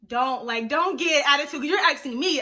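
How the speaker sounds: loud imitation of a telling-off; scolding mother. Mom warning daughter about her attitude, mock scolding. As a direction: scold loudly, like an annoyed mom.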